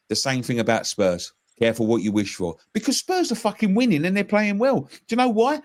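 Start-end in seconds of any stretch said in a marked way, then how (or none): none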